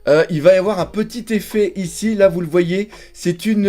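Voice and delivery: ordinary talk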